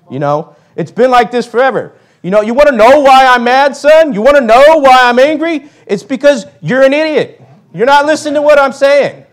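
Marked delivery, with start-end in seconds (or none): 2.4-9.2 mock-angry father scolding his son, higher and louder; a dad losing patience and shouting at his son; exasperated dad. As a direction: play the fed-up dad yelling at his kid. Preacher voices an exasperated dad.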